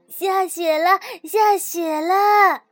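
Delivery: loud, slow, delighted cheering; joyful cheer. A narrator's reading. The rabbit runs outside to the snow, loud joyful cheering. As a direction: shout it out like a kid seeing first snow. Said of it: "欢呼着跑出去".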